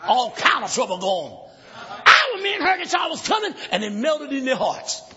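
forceful shouted sermon with rhythmic pauses; full-force preaching. Acted. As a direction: declaim the story to the congregation.

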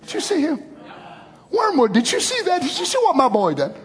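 high-pitched, excited proud father; God marvelling. Acted. God pointing out his praying child to Wormwood, excited pride. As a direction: brag in a high voice about your boy. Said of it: "God said".

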